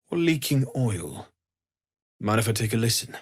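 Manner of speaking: ordinary talk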